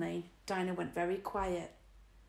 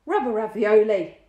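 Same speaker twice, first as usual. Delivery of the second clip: loud exasperated burst; loud frustrated exclamation; outburst; 3 semitones higher, 12 dB louder than usual. A narrator's reading. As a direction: blurt it out, fed up. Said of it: "Lloyd burst out".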